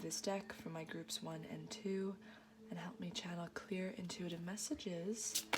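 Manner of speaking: hushed, voiceless whisper to the spirits; whispered prayer. Real, not acted. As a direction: breathe the request reverently.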